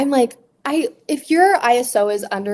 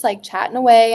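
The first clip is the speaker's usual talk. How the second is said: ordinary talk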